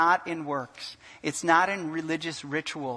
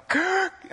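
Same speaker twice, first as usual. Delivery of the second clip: sudden high-pitched gasp; sharp mock gasp; imitated gasp; 13 semitones higher than usual. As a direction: mimic passengers gasping as a window opens. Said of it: "everyone would go".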